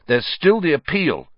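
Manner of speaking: high, insistent encouragement; fierce. Acted. Ron urges Hagrid not to despair, fierce and high. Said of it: "said Ron fiercely".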